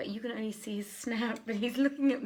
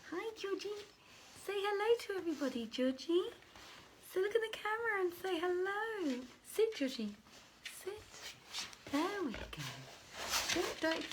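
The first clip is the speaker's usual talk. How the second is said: much higher sing-song voice for the dog; dog-talk voice; 10 semitones higher than usual. Real, not acted. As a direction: coo at the dog in a high pet voice. Talking to her dog.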